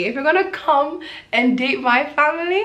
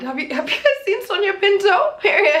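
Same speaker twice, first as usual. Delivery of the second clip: higher, giggling through the question; laughing gush; 9 semitones higher than usual. Real, not acted. Gushing to viewers about coworker Sonia, laughing.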